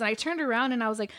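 usual speaking voice

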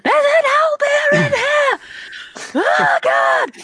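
high, drawn-out, full-volume scream; panicked screaming. Acted. Player's character spotting an owlbear, panicked screaming. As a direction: scream the alarm in sheer panic. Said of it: "she just starts screaming immediately".

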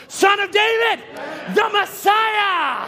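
high shout, last word stretched; exultant proclamation. Acted. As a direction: proclaim the Messiah with all your might. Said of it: "His response was".

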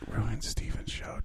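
eerie whisper urging sabotage; breathy ghostly whisper. Acted.

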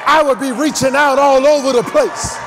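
loud, driving sermon with punched phrases; full-force preaching. Real, not acted. Preacher telling the congregation to touch their neighbor, full-force preaching.

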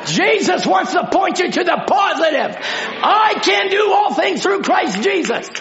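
preaching shout. Real, not acted. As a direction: cry it out to the congregation. Preacher declaring to congregation.

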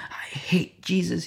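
hushed, whispery imitation of crying; barely voiced, as if choked by tears; retold crying. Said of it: "crying uncontrollably like a little child".

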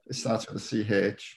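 ordinary delivery

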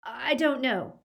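a touch of fear, unwilling to commit; reluctant boy's uncertain answer; reluctant Draco. A narrator's reading. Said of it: "Draco's expression was full of reluctance".

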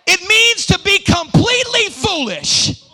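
high, loud declaration that drops at the end; loud, high-pitched pulpit cry; emphatic preacher's shout. Real, not acted. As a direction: holler it out to the congregation. Shouts climax of definition.

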